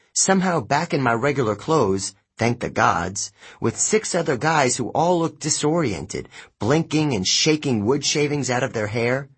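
plain reading voice

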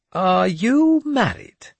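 put-on high, slow, grandly pious old madman; a cracked holy man delivering God's message; mad bishop voice. Jeremias questions priest.

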